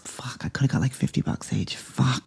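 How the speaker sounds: hushed frustrated whisper; whispered swearing. Real, not acted. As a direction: mutter it under your breath in dismay.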